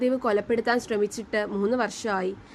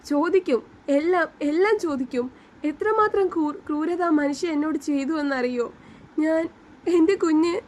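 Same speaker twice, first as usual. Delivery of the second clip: high, wailing, breaking voice; heartbroken crying; 5 semitones higher than usual. A narrator's reading. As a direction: sob out the loss of her baby. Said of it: "അവൾ നെഞ്ച് തകർന്ന് കരഞ്ഞു". (നെഞ്ച് തകർന്ന കരച്ചിൽ)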